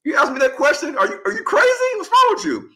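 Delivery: very high, incredulous mock scolding; squeaky-high retold outrage; scandalized friend. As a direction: imitate someone appalled by a silly question. Retold friend scolds a naive question.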